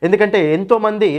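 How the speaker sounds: teacher plugging his course app to students, lively ad read. Acted. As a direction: pitch the course like a promo.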